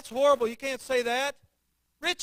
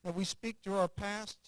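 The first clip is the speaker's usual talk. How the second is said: prayer; 4 semitones lower than usual. Acted. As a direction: pray it gently then rise in fervour. Closing prayer.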